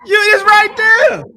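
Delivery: high, loud pointing it out; excited. Real, not acted. Preacher pointing out the proof to chat, high and loud.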